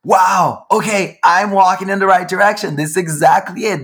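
like a sudden revelation hits you; amazed realization. Retold or imitated.

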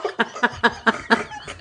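breathy, voiceless cackling fit; real giggles breaking up the reading; helpless laughter. Real, not acted. Reader losing it over the story, helpless laughter.

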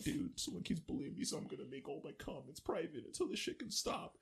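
hushed, soft video-confession voice; mock victim. Bullied person posting a video to followers, softly imitated victim. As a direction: speak softly, like a wounded bullying victim's post.

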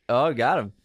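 ordinary talk

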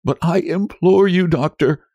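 high, imploring wail; desperate wife's plea; despairing moan. A narrator's reading. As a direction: implore him, pitched well up. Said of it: "Nellie moaned in despair".

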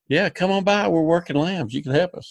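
imitating Carl's invite. Retelling Carl's phone invite, in a high cheerful voice. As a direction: pitch it way up like a welcoming host.

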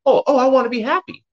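high, eager mock reply; a stranger's eager mock answer; eager random person. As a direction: play a bright, quick yes from a stranger. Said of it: "ask any random person".

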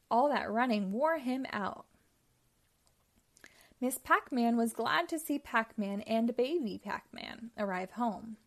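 ordinary delivery

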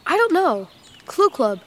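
normal talking voice

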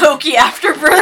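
loud, high burst through laughter; cracking up. Real, not acted. Player riffing on wine tasting notes, cracking up loudly. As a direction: shout it while losing it laughing.

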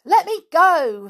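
put-on high, loud cry of a caught boy; boy's voice. A narrator's reading. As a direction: cry out to be released. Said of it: "said a boy's voice".

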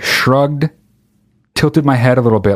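ordinary talk, nothing marked

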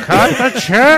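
slow drawn-out laughing echo. Real, not acted. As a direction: stretch it out while laughing.

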